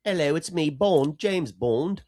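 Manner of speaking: high, loud mock-English accent; bad English accent. Shown as a demonstration. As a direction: put on a hammy English accent, drawing out the name. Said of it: "my version of an English accent".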